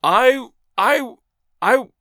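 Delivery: high, loud broken false starts; stuttering. A narrator's reading. Agent lost for words at Makola, stuttering. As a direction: stutter with outrage, unable to go on. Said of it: "stuttered Kayerts".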